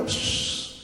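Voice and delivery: soft unvoiced shush; hushing shh. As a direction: shush them quietly. Hushing the child.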